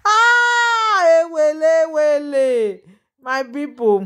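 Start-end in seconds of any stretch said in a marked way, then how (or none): 0.1-2.8 much higher, louder wailing ah ewele; mock lament. Reacting to the gossip for viewers, she wails in mock lament. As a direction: cry out in theatrical woe, fading down.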